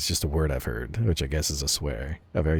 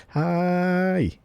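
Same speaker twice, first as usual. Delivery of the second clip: high, long drawn-out greeting; imitating her odd greeting; 12 semitones higher, 3 dB louder than usual. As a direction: mimic a cutesy hello, stretched right out. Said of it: "Why'd she say it like that?".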